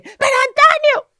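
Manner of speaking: high, loud scolding shout; scolding mother. Retold or imitated. Mother shouting at her son, high and loud. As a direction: yell his name like a fed-up mum. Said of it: "Y la madre".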